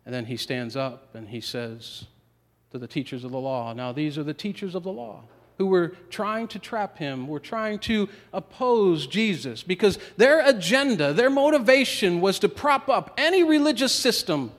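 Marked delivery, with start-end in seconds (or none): 3.7-14.5 preaching at full force. Acted. Preacher builds on their agenda.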